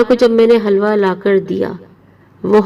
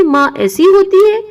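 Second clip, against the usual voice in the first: hurt high protest; 9 semitones higher than usual. Retold or imitated. Niece protesting to her cruel aunt, high and hurt. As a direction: demand it, voice jumping up in hurt.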